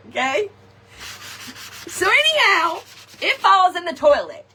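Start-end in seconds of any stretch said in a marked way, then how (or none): 1.9-4.4 higher and louder, rushing into it; sudden panic in the voice; panicked. Real, not acted. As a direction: sound alarmed as it all goes wrong.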